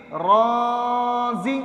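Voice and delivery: long-held را, then short زی; drawn-out madd. Shown as a demonstration. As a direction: stretch only را on a steady tone, then a brief زی. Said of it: "یہ ہم نے بنایا را مدہ". (را کھینچ کر، زی چھوٹا)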